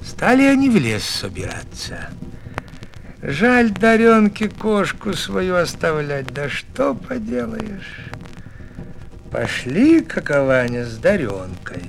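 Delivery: ordinary delivery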